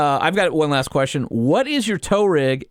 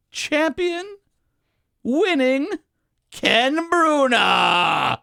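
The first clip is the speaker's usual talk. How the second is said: high, very drawn-out announcer call; hammed-up arena announcer; 11 semitones higher, much slower than usual. Co-host announcing the winner to a nonexistent crowd, hammed-up announcer. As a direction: stretch the names out, pausing between phrases.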